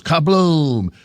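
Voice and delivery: mock explosion. Nachos explode in the shaking seat.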